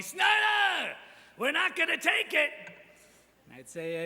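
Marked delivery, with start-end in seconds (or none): 0.0-2.5 high, loud mocking hallway shout; imitating seniors yelling. Seniors taunting him in the hallway, loud mock shout. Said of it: "seniors would yell at me".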